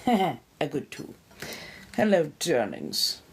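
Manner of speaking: normal talking voice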